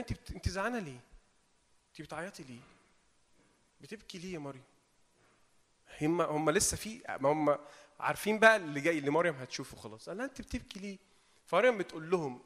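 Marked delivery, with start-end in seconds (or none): 0.0-4.6 very soft, consoling murmur; gently pat her with the words, hushed; tender soothing. Acted. Angels comforting weeping Mary, tender and very soft. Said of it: "ازاي الملاكين بيطبطبوا عليها". 10.3-11.0 hushed, gentle question; tender soothing. Acted. Angels asking Mary why she weeps, softly soothing. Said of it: "ازاي الملاكين بيطبطبوا عليها".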